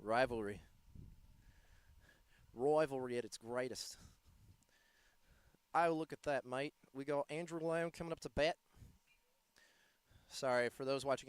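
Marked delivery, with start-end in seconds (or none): 0.0-6.7 put-on a light Aussie accent sliding into Kiwi; Australian into New Zealand accent. Kiwi-tinged Australian commentary on the rivalry. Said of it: "quickly went from Australian to New Zealand".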